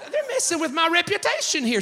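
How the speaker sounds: high-pitched flustered protest; hammed-up wounded husband complaining; mock indignation. Continues indignant retort to wife.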